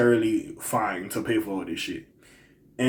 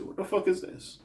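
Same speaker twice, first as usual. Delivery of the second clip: whispered, much softer disbelief; 8 semitones higher, 9 dB quieter than usual. Real, not acted. Repeating his reaction to her backpedaling, whispered disbelief. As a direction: breathe it out in hushed disbelief.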